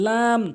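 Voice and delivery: "লাম" with a long held aa; correct pronunciation. Shown as a demonstration. Teacher models the Arabic letter, long "লাম". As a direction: draw out "লাম" evenly. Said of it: "সঠিক উচ্চারণ".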